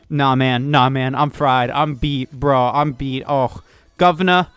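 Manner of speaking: a rough imitation of a working-class British accent; mock British bloke, tired. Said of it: "I don't know if that was a good British accent or not".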